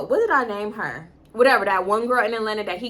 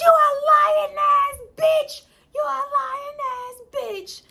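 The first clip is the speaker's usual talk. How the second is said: retold screechy accusation; 4 dB louder, slower than usual. Accusing boyfriend of lying about his sister, screeching.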